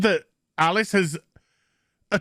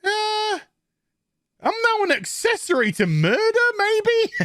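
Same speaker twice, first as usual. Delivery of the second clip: mock unsure Batwoman; 13 semitones higher than usual. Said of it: "is like".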